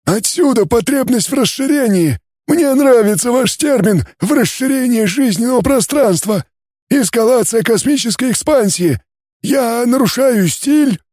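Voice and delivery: high, strange alien timbre; a cool, detached alien scholar. Acted. Alien scientist character voice. (высокий голос инопланетянина)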